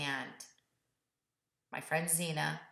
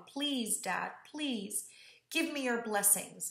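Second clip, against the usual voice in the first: sobbing plea; 4 semitones higher than usual. Retold or imitated. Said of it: "I'm just sobbing".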